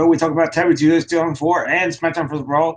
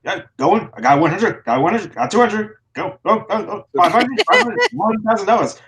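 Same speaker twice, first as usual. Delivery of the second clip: fast, higher rapid-fire auction call; auctioneer; 7 semitones higher than usual. Pretend. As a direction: rattle off bids like an auctioneer. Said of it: "[name] should be an auctioneer".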